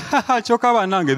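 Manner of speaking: high mock laugh of a wife; a hint of a giggling wife; wife's laugh.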